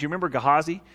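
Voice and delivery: ordinary delivery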